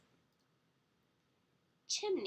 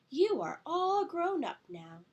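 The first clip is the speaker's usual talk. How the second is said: higher, louder storybook mother; mother pig; 8 semitones higher, 6 dB louder than usual. Acted. Mother pig sends her three little pigs off, emphatic. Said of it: "the mother pig said to the three little pigs".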